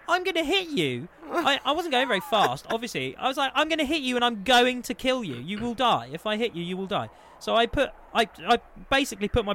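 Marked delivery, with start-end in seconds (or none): 0.1-1.1 high, loud retold panic; alarmed. Driver to man in the road, retold alarm. 3.6-7.1 high, loud retold warning easing down; alarmed warning. Driver warning the man he nearly hit, retold alarm.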